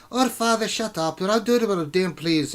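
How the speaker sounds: high-pitched bickering daughter; lightly voiced squabbling daughter; arguing daughter. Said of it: "they're cussing at each other".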